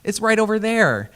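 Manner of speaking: syrupy Midwestern helper. Retold or imitated. Local sweetly pointing a lost tourist the way, sugary high voice. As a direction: pour on the honey like a doting local.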